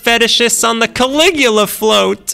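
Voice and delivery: frantic commentator. Acted. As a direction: wail it to the heavens. Parade chaos.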